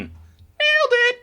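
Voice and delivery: high, slow gloating cheer; triumphant. Real, not acted. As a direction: crow over a barely passing grade. Celebrating a passing grade.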